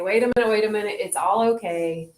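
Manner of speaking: low soothing inner voice, grounded; calm self-talk. Shown as a demonstration. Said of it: "get back to the earth and say".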